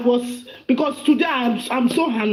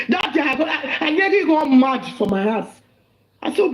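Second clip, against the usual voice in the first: usual speaking voice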